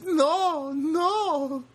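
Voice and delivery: very high, giggly imitation of Rosie; coy refusal. Imitating Rosie refusing a picture, squeaky and coy.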